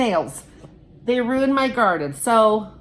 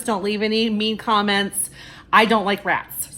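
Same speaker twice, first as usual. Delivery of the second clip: ordinary delivery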